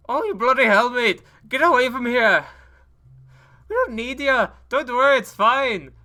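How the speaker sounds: put-on high, loud British-accented taunt; shouting at the rival in a fake British accent. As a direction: yell out the window in a mock British accent. Rolls down window, taunting rival.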